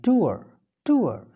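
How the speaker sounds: "टूअर" with a gliding uh ending, r barely there; British "tour". Shown as a demonstration. Teacher demonstrates the British pronunciation of tour. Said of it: "मैं आर को आराम से पढ़ा हूँ".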